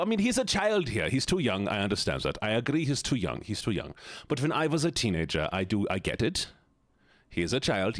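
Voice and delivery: put-on comic German accent, flustered reasoning. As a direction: talk it through in mock German accent. Keeps up German voice.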